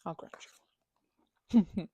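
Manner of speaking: ordinary talk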